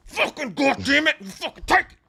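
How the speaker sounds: high, strained angry cursing; mock furious. Killer slamming her head on stairs, mock-furious high cursing. Acting out the killer's stomping attack.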